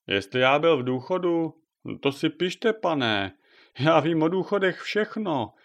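put-on an old neighbour carried away about his retirement; excited old neighbour. Krákora rambles about pensions.

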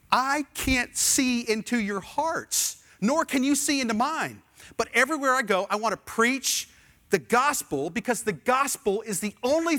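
a passionate preacher's rising appeal; preaching at full force. Acted. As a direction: thunder it out from the pulpit. Climax of the sermon.

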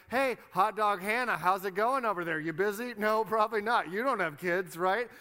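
imitating a jeering, gloating rival; taunting rival. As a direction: needle them loudly with fake innocence. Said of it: "And then Peninnah's like".

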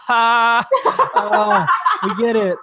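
high forced laughing through the words; awkward laugh. Retold or imitated. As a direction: laugh it off nervously, pitch jumping up. Carlisle laughing awkwardly at joke.

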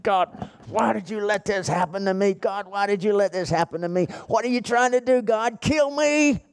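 high, strained re-enacted cry to God; retold anguished outcry. Preacher reliving pleas to God in the car, anguished outcry. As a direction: cry out in desperate protest, reliving it. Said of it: "banging the steering wheel of my car".

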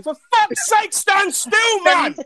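high, loud mock yell at a shaky camera; imitating a fed-up shout; high exasperated mock shout. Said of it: "And I'm like".

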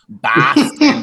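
put-on Boston accent. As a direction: clip Boston the Boston way, loud and high. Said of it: "Boston accent".

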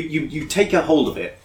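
ordinary talk, nothing marked

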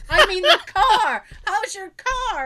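loud, high, slow clarification; exasperated correction. Retold or imitated. Dad asking after the orange car, exasperated.

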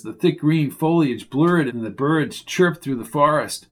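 ordinary delivery, nothing marked

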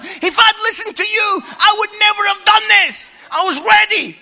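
high, loud indignant outburst; giving it straight. Retold or imitated. Comedian imagining telling the pastor off, high indignant shout. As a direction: let rip at him, pent-up and shouting. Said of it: "gonna give him it straight".

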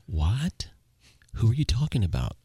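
high, questioning radio reply; retold bafflement. Relative answering a confusing radio call, imitated bafflement. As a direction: imitate someone puzzled on the other end.

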